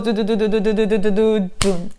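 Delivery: rapid drum roll imitated by voice; vocal drum roll with a final beat; playful mouth-made drum roll; spoken drum roll. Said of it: "drum rolls".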